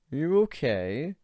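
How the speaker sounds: high, falsely sweet concern; fake-sweet question to the narrator; mock sweet. A narrator's reading. Ivan asking with fake sweetness. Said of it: "he asked sweetly".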